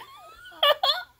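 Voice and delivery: high, loud squeal of laughter; high joyful laugh. Real, not acted. Joking that the doll agreed, laughing loudly. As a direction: burst out laughing.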